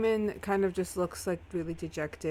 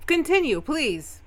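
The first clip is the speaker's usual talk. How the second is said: raised, loud in-character insistence; tense demand; 9 semitones higher, 6 dB louder than usual. Acted. Samuel pressing Eamon to continue, sharp raised demand. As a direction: jump high and loud on the demand.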